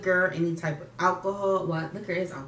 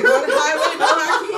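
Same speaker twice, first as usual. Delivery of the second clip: laughing while quoting; giggling through the words; 9 semitones higher, 10 dB louder, faster than usual. Retold or imitated. Laughing mimic of warning.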